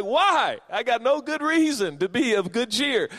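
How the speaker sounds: high, loud, indignant protest; protesting listener. Acted. Listener objects to the stranger's cheer, yelling. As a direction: holler back in disbelief. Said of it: "And you're like".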